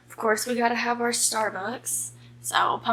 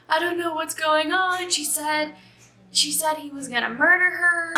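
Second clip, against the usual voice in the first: imitating bawling; 6 semitones higher than usual. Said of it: "and she's bawling".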